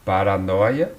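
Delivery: normal talking voice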